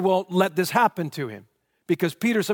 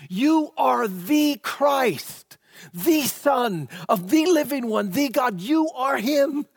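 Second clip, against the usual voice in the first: proclaiming confession; 7 semitones higher, slower than usual. Retold or imitated. Preacher voicing Peter hailing Jesus as Christ, slow ringing proclamation.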